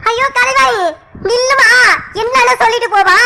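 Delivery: high-pitched plea to stop; urgent calling after. Acted. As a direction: call out anxiously after someone leaving. Calling after niece who walks off.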